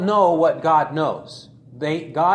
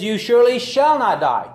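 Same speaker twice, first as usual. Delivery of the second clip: louder, higher defiant contradiction; serpent's denial; 6 semitones higher, 6 dB louder than usual. Retold or imitated. Preacher voicing the serpent contradicting God, loud and defiant. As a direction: throw the serpent's denial out boldly. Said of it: "He says".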